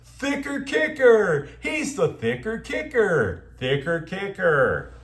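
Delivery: mock a pundit relishing a catchphrase; gushing talking head. Talking head repeating the kicker's nickname, mock gushing. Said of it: "every talking head is going to love saying this over and over".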